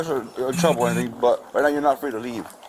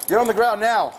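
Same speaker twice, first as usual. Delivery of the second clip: high, forceful police command; shouted surrender demands; 7 semitones higher than usual. Real, not acted. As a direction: bark the order down at him. Officer orders suspect to ground.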